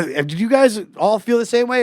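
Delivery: mock-sweet challenge to the audience; calling to the crowd.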